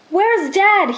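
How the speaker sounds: calling out. A narrator's reading. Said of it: "he called out".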